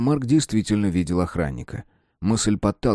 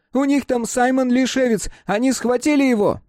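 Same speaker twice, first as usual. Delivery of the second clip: put-on high raised boyish shout. A narrator's reading. Mark shouting that Simon has been grabbed, high and raised. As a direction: shout it down the street. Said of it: "Крики Марка".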